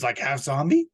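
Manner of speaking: usual speaking voice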